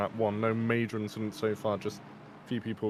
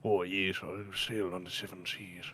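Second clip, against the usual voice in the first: put-on pirate voice, trailing softer; mock seafarer's reply; pirate impression; 3 semitones lower, 4 dB quieter than usual. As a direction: play along as an old-salt pirate. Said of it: "So you can do a good pirate".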